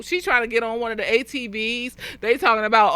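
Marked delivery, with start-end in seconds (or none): none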